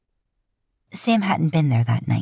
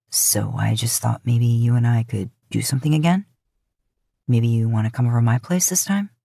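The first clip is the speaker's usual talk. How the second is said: lower, easygoing male voice, casually inviting; lower male love interest; 6 semitones lower than usual. Acted. Man on the phone inviting her over, lower, easy voice.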